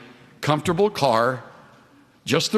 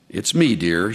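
tender reply; 7 semitones lower than usual. Retold or imitated. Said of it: "she answered tenderly".

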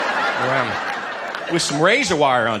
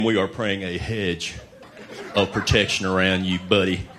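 mock pious prayer; 7 semitones lower, slower than usual. Mock pious prayer, low slow.

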